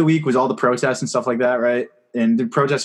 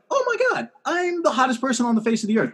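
much higher, mock-thrilled gush; giddy delight; 11 semitones higher than usual. After a compliment, squealing self-delighted gush. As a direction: gush as if just called the hottest person alive. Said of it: "You're like".

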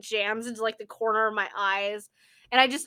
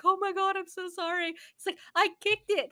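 put-on pitched-up frantic boy apologizing; panicky kid; 8 semitones higher than usual. Kid who kicked the ball apologizes, higher and panicky. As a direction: play the sorry kid, higher.

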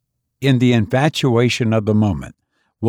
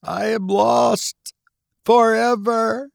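1 despairing wail; 11 semitones higher, slower than usual. A narrator's reading. Student in frenzy, despairing.

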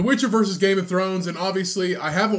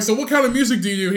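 fast high quote; 8 semitones higher, 6 dB louder, faster than usual. Retold or imitated. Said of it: "They're like".

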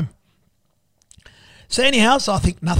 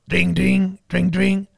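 imitated phone ring; 2 semitones higher, much slower than usual. Phone ringing at six am.

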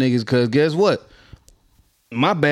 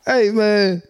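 usual speaking voice

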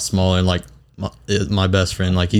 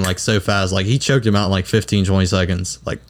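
ordinary delivery

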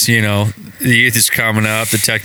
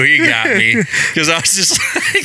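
laughing through the words; amused giggle; 7 semitones higher than usual. Real, not acted. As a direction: chuckle as you say it. Laughing recounting.

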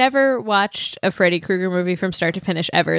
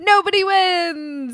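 mock dramatic; 11 semitones higher, much slower than usual.

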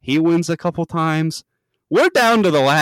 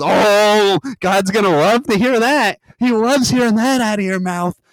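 raised, louder smug mockery; smug taunt; 8 semitones higher, 5 dB louder than usual. Dick mocking Jamaica's swearing: raised, smug taunt. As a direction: needle her with fake delight. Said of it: "He goes".

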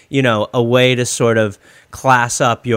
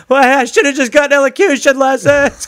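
much higher, mock drowning uncle voice; a hammed-up panicky uncle in the water; 13 semitones higher, 5 dB louder than usual. Uncle drowning off the cruise liner, mock panicked voice. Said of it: "he's just there like".

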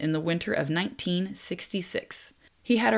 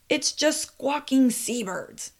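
mild mock shout; 6 semitones higher, slower than usual. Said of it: "Peter shouted back".

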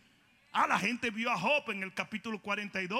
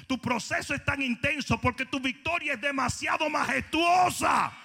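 fiery preaching; 5 semitones higher than usual. Acted. Preacher proclaims victory over music, fiery.

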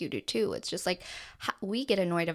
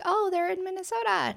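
naive surprise; 10 semitones higher than usual. Retold or imitated. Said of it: "be like".

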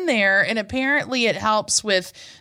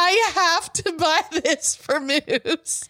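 slow, higher, laughing through it; 6 semitones higher, slower than usual. Real, not acted. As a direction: giggle it out slowly. Laughing while explaining the gift.

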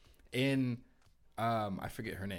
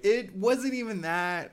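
high, slow and unimpressed; 10 semitones higher, 8 dB louder, slower than usual. Real, not acted. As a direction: drag it out, unimpressed. Mocking the manga, laughing along.

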